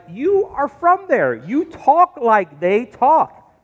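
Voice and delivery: high, drawn-out accusing words; pointed accusation. Acted. Bystanders catch Peter by his accent, accusing. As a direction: stretch it out, pitch up, as proof. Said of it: "and they're like".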